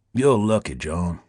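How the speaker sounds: low, honeyed Deep South purr; languid Southern drawl. A narrator's reading. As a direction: purr it like a Southern plantation owner. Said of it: "languid southern drawl of a plantation owner".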